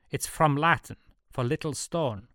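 chirpy Swiss German explainer; Swiss German Jojo. A narrator's reading. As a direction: explain helpfully in Swiss-German-accented English.

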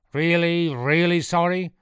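high, loud, incredulous question; demanding. A narrator's reading. Priest testing Sean's apology, loud and demanding. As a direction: press the question loudly.

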